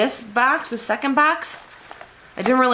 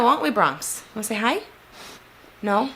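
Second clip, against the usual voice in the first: talking to the dog; 4 semitones higher than usual. Real, not acted.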